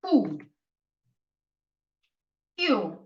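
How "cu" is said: "Cool" said as "koo", no final L; "Cool" as a lone "koo"; Italian letter name. Shown as a demonstration. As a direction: say "Cool" like Italian cu, ending on the vowel. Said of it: "You have to use the word cool".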